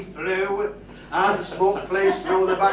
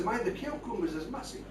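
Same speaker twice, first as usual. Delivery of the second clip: laughing; 3 dB quieter, faster than usual. Real, not acted. Punchline then laughter.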